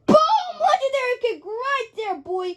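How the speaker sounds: real hyped yell at the screen; excited shout. Real, not acted. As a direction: cheer the big kick. Excited about the big kick.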